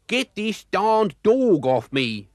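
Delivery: high, loud, slow shriek in a put-on voice. A narrator's reading. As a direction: shriek it in a panic. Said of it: "shrieked McSneak".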